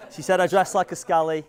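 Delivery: normal talking voice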